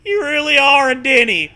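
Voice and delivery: hammed-up high horse voice; loud high silly ghost horse. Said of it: "the ghost horse says".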